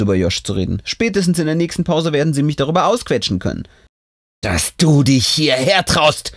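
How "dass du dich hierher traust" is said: slow, seething accusation; angry. A narrator's reading. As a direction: spit out the outrage slowly. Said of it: "die wütende Stimme von Lea".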